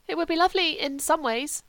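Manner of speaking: high, wistful invalid; lightly voiced Sebastian. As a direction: lightly play a vague, dreamy friend.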